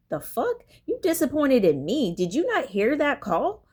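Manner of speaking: high-pitched indignant disbelief; incredulous retort. Retold or imitated. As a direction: push back, pitch jumping up in disbelief. Acted retort to disappointed supervisor.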